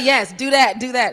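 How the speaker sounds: excited, loud and high; eager approval. Retold or imitated. Director cheers actor's own reading, loud excited approval. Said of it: "He's like".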